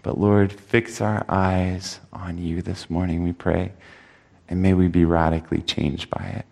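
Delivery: soft, low prayerful voice. Acted. Pastor closing the prayer before the sermon, soft and low. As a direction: pray it quietly and humbly.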